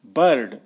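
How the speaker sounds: बर्ड with a clear R before the d; American R in बर्ड. Shown as a demonstration. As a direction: sound the R in बर्ड. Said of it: "American करते हैं".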